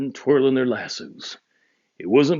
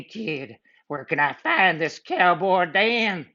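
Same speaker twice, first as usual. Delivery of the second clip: outlaw voice; 8 semitones higher than usual. Acted. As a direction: menace like a cartoon outlaw.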